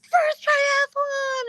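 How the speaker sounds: very drawn-out high yell; cheering shout. Retold or imitated. Friend Bridget cheering her first race, high long shout. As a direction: whoop it loud and long.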